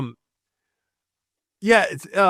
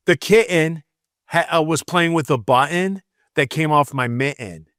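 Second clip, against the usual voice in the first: Michigan accent, T's dropped in kitten, button, mitten; lazy Michigan speech. Shown as a demonstration. Demonstrating lazy Michigan speech to co-host, Michigan accent with dropped T's. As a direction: swallow the double T's, Michigan style. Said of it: "lazy Michigan speech".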